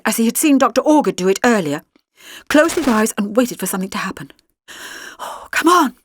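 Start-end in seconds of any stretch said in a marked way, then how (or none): 5.2-6.0 high, breathy plea; breathed. A narrator's reading. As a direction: breathe it out urgently. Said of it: "he breathed".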